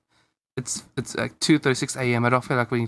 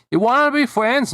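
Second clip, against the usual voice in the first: put-on high, hopeful kid voice, a bit louder; eager child asking; 9 semitones higher, 3 dB louder than usual. Larry asks his new friend, in a high hopeful kid voice. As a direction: ask it like a shy boy hoping for a yes. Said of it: "Larry asked".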